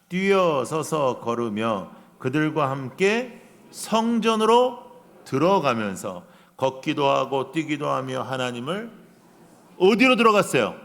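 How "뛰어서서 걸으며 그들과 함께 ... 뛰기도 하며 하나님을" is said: slow scripture reading. Shown as a demonstration. Pastor leading the congregation in the verse, slow and louder. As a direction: read the verse slowly and louder. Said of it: "팔 절 시작".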